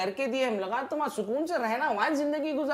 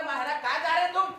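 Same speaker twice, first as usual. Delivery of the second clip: normal talking voice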